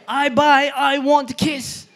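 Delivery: high, loud, slow insistence; adamant. Retold or imitated. Mom demanding a kiss from grocery packer, adamant mock shout. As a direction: demand it slowly, hammering each word. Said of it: "my mom is very adamant".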